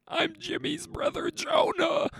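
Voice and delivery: high put-on voice, whispered then shouted; goofy made-up brother voice; silly character voice. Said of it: "How long do you think I could keep that voice up".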